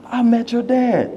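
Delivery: put-on high, eager girl's voice; excited classmate. Valedictorian telling classmate she met his dad, excited and high. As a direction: tell him you met his dad, thrilled. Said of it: "comes to me excited".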